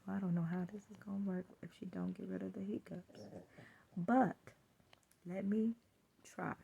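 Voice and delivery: whispered inner thought. Retold or imitated. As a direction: whisper it to yourself. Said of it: "thinking to myself".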